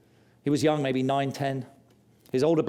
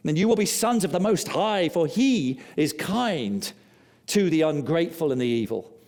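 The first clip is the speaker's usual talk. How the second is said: fervent preaching; 4 semitones higher than usual. Acted. Preached scripture climax.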